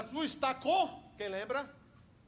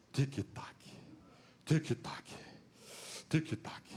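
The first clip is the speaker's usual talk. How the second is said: low ticking clock; 7 semitones lower, 6 dB quieter, slower than usual. Acted.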